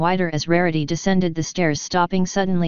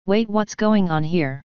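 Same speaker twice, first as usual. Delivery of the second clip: ordinary delivery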